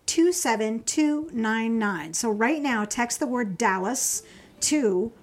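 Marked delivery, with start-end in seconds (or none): 0.0-2.1 slow number read. Real, not acted. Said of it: "here's the number".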